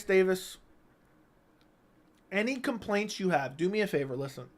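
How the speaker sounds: usual speaking voice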